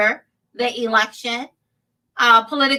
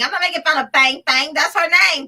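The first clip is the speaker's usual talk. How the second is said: ordinary talk, nothing marked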